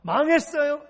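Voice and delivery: loud, high shouted question; challenging shout. Acted. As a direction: fire the question at the congregation. Acted question-and-answer with congregation.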